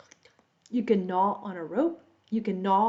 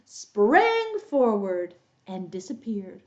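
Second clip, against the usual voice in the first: usual speaking voice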